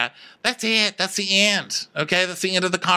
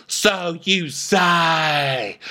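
very slow, drawn-out disdain; Diana; 3 semitones higher, much slower than usual. Acted. Diana doubting Sutton, slow theatrical disdain. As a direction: stretch every word dismissively. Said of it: "I'll be Diana".

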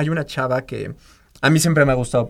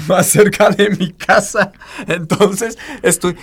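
louder, a laugh breaking in; 5 semitones higher, 7 dB louder, slower than usual. Real, not acted. Singer laughing about her father's practical career choice. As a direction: chuckle through the punchline.

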